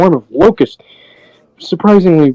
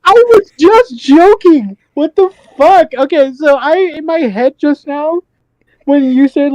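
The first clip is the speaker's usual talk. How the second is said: much higher, laughing disbelief; high excited; 11 semitones higher than usual. Real, not acted. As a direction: blurt it out amazed.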